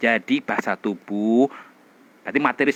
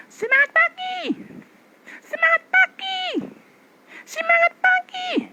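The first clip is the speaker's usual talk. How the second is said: high, shouted throat-voice cheer; MC greeting, throat voice. Shown as a demonstration. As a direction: yell it like a group MC from the throat. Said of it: "Ini masih sini loh". (sapaan MC dengan suara tenggorokan)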